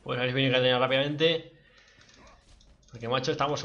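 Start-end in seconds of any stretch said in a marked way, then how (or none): none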